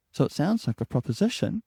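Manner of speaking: tentative uplift on proposition; rising upward inflection. Shown as a demonstration. Coach demonstrating the tentative habit, rising tune at the end. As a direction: lift the end of the sentence like a question. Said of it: "I'm doing it now".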